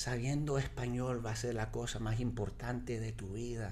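quiet, native-sounding Spanish; softly spoken Spanish advice. Retold or imitated. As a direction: quote grandma softly in Spanish. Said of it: "I'll say it in Spanish".

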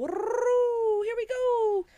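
high, drawn-out mock whoop; whooping take-off cry. Host playing high reindeer taking off, gleeful whoop. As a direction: launch into a long high cheer.

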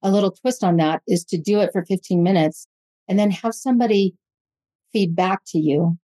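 normal talking voice

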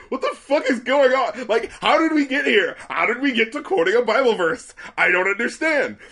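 high-pitched, baffled outburst; retold bewilderment. Recalling first hearing the Bible verse, retold bafflement. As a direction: re-enact total confusion, voice shooting up. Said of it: "that confused the shit out of me".

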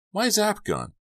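very high-pitched exclamation; sudden realization. A narrator's reading. Boy remembering his missing zap gun, exclaiming. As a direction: blurt it out high.

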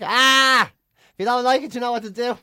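loud mock dismissive airline boss; Michael O'Leary. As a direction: open with a loud, drawn-out 'ah' and wave them off. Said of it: "Even Michael O'Leary's like".

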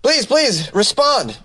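put-on begging for help; mock pleading. Caller begging the critical incident response team, mock pleading.

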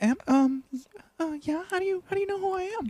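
put-on very high, soft childish voice; little girl voice. The girl answering fans in a tiny high voice. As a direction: play a shy little girl. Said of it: "She's like".

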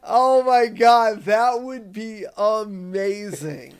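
loud, drawn-out, high-pitched delight; excited gushing. Real, not acted. Host thrilled by the Funko Pop moment idea, excited gushing. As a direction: gush loudly, stretching out the words.

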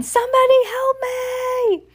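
high, drawn-out wail; long, high storybook yell; yelling for help. A narrator's reading. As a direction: squeal slowly for rescue. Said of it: "Heidi yelled again".

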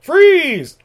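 high, loud bark of command; mock cop shout. Cop at the chemical plant, loud high shout. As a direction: yell it like a panicky cop.

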